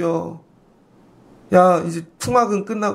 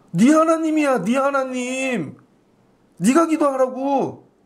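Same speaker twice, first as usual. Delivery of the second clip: 네 하나님 raised and drawn out slowly; pointed mock retort; 5 semitones higher, slower than usual. Shown as a demonstration. Preacher mimicking Israel's words back at them, drawn out. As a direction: lean on 네 하나님, slow and pointed. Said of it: "mocking Israel's distance from God".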